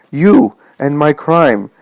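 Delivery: high, loud panicked outcry; terrorized. A narrator's reading. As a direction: build to a frantic, loud cry. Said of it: "The man is terrorized".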